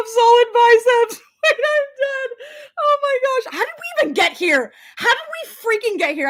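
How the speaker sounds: real laughing fit. Real, not acted. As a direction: lose it over the compliment. Laughing at bicep comment.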